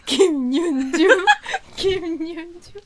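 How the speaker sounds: loud laughing self-cheer. Acted. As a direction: cheer your own name, cracking up. Caller cheers herself, laughing.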